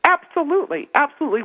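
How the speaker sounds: repeated high, falling absolutely; eager repeated yes; emphatic agreement. Real, not acted. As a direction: stress each absolutely, insisting.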